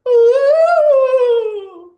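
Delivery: very high, loud drawn-out ooh; mock swooning ooh. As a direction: squeal like a smitten girlfriend.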